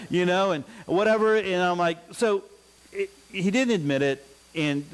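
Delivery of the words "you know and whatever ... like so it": ordinary talk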